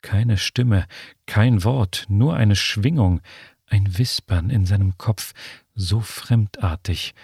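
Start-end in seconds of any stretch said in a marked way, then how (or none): none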